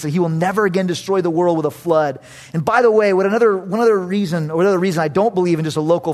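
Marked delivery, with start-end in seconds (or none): none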